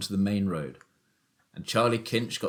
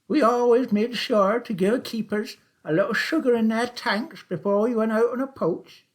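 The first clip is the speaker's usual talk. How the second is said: put-on high, loud old countryman's voice; sly old taxi driver; 12 semitones higher, 5 dB louder than usual. A narrator's reading. Old Charlie teasing Willum about the shoot, high and loud. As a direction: play the crafty old poacher, much higher and louder.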